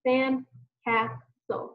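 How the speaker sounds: slow "Sandcastle" in three beats, sand, cas, tle; "Sandcastle" tapped out syllable by syllable; split into syllables. Shown as a demonstration. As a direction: pause after each syllable of "Sandcastle". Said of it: "jump however many syllables".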